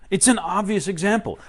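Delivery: usual speaking voice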